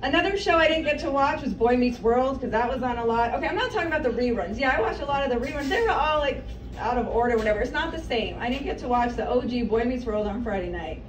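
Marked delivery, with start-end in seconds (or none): none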